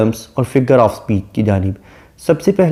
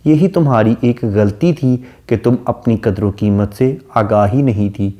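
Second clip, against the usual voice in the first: normal talking voice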